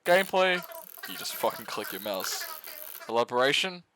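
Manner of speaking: ordinary delivery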